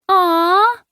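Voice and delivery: put-on high, loud childish whine; whining. A narrator's reading. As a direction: whine like a disappointed kid. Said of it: "Lily whined".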